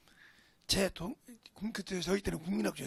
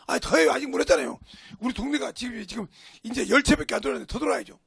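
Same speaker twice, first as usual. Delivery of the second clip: loud, pushy retort; re-enacted stubborn protest; defiant insistence; 3 semitones higher, 10 dB louder than usual. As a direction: insist on carrying on, louder. Retold defiant reply to his father.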